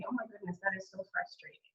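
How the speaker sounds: a hushed, caring validation; soft validating. Shown as a demonstration. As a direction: soothe them quietly. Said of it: "You say".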